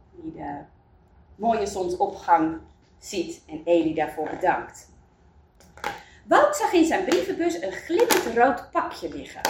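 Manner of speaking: usual speaking voice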